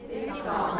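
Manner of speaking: "Diphthong" said softly with an f; diphthong with f sound. Shown as a demonstration. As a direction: say "Diphthong" as dif-thong. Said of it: "Can you say diphthong?".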